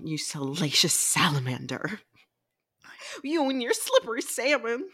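lightly hammed-up flirty line; a hint of a saucy lover; mock seductive impression. Friend quoting a flirty book character. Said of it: "we'll leave our impressions there".

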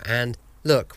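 normal talking voice

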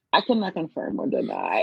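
low, guarded mock official answer; guarded non-answer. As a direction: imitate a man carefully dodging the question. Imitates husband's guarded interview answer.

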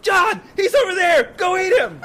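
very high, loud squeaky dolphin; shouting dolphin voice. Acted. Host voicing dolphins hunting fish, high shout. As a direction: yell urgently to your pal. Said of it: "They're like".

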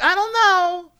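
high, exaggerated insistence; acted stubborn indignation; mock defensive arguing. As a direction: argue back in a silly high voice. Acting out stubborn argument with chat.

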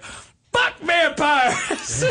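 high, slow, drawn-out yell; homeless man yelling. Retold or imitated. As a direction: bellow it at the crowd. Homeless man yells at fans.

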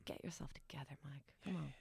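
soft, breathy self-talk under her breath; hushed, stern self-encouragement; whispered pep talk. Retold or imitated. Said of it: "a little pep talk".

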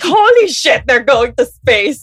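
stunned, rising excitement; excited. Retold or imitated. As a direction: gasp it out in disbelief. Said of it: "It's like".

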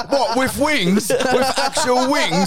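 put-on high, disbelieving English accent; baffled English mate, laughing through it; put-on English lad's voice; English pub bloke. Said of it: "some English guys sitting around going".

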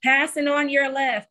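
high, bright call-out while passing; passing cyclist calling out. Retold or imitated. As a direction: sing out the warning cheerfully.

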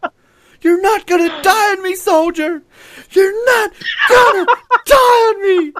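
high, loud battlefield yell; man down shout. Acted. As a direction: yell for a medic over a fallen soldier. Acted battlefield rescue.

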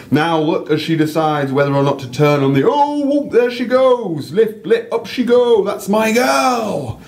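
a northern English guide thrilled as the eagle lifts; excited North English accent. Acted. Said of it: "random North English accent".

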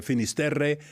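usual speaking voice

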